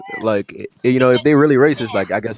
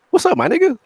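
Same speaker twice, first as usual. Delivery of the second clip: much higher, breezy hello; friendly greeting. Retold or imitated. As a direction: greet him brightly, very high. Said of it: "And he was like".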